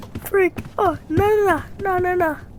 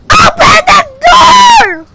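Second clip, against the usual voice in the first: demanding yell; 8 semitones higher, 8 dB louder than usual. Real, not acted. Yelling at a closed door.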